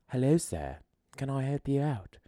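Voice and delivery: high, soft mock British lady greeting; old British woman's accent. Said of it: "an old British woman's accent".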